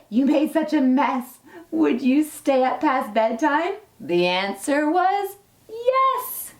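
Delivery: gentle, lilting storybook read-aloud; reading to children. Acted. Teacher reading a dinosaur story to her class, storybook lilt. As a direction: read it like a bedtime picture book. Reads a picture book to children.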